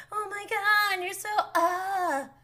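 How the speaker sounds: mock enamored. Ex mimicking the girl gassing him up, mock enamored. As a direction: put on a swooning fangirl voice. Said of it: "gonna act, like, enamored with you".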